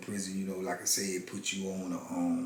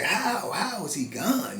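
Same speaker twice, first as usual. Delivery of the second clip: much higher "How?" then slower; stunned disbelief; 9 semitones higher, slower than usual. Retold or imitated. Grieving son asks himself how his mentor died, stunned and high. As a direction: inner cry of disbelief, the first word leaping up.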